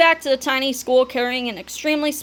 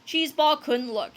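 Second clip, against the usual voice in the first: ordinary delivery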